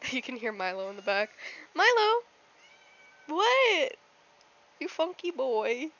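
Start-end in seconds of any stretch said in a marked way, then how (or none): 1.7-5.9 high cooing voice to the dog; pet voice. Real, not acted. Talking to dog in baby voice.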